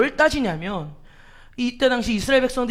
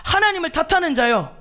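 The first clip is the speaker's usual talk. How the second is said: higher, louder call with 하나님을 hit hard; God's challenge; 7 semitones higher, 4 dB louder than usual. Acted. God confronting Job, raised calling voice. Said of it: "주님이 말씀하시는 거예요".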